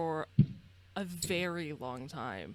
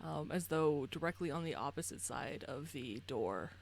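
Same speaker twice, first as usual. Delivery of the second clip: normal talking voice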